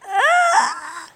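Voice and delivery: sharp high scream of pain; high pained cry. Acted. Captive Lance hurt by the villain, high pained cry.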